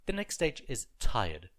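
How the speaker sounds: ordinary delivery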